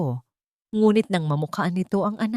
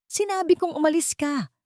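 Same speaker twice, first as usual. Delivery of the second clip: sharp yell pitched much higher; acted shout of an angry son, voice leaping up; 11 semitones higher than usual. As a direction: snap the order up high, losing patience. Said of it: "sigaw ni Eduardo".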